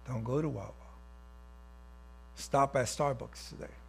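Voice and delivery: soft, low inner voice; tiny little voice. Acted. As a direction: drop low and soft, like a small inner prompting. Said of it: "you hear a tiny little voice".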